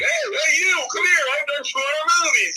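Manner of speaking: hammed-up braggart calling people over to show off; mock bragging show-off. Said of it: "I don't just go blabbing it around like".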